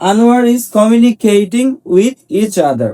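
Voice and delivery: slow, spaced-out drill sentences. Shown as a demonstration. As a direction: drill each word slowly for the class. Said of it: "teacher drills example". (ধীরে ধীরে অনুশীলন বাক্য)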